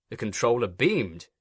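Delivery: usual speaking voice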